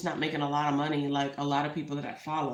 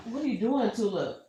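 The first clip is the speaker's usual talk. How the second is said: scolding the cat; 5 semitones higher than usual. Real, not acted.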